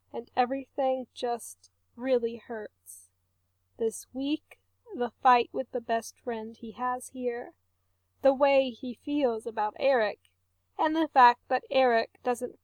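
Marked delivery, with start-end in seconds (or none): none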